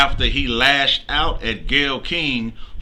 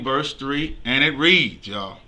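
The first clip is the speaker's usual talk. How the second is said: normal talking voice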